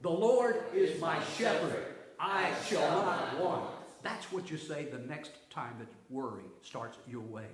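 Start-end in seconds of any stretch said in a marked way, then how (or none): 0.0-3.7 slow and loud, every word drawn out; loud, slow recitation with the choir. Shown as a demonstration. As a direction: lead the congregation in unison. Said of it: "Let's say it".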